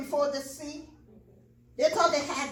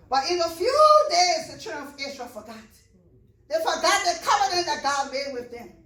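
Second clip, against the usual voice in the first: rising, louder preacher's cadence; preaching at full force; 4 semitones higher, 4 dB louder than usual. Acted. Pastor exhorting the congregation, preaching at full force. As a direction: drive the point home to the church.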